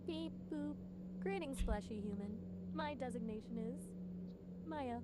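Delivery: deliberately glitchy, hammed-up robot voice; bad robot voice. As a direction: play a cheesy robot on purpose. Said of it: "Maybe a really bad robot voice?".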